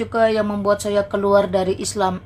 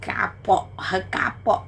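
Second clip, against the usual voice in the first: taunting whisper; 6 dB quieter, slower than usual. Acted. Taunting a viewer who left.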